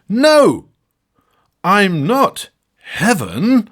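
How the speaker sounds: high, loud, slow protest; hammed-up shocked refusal; indignant nun. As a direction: cry out like an affronted nun. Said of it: "but Winsome Nunn says".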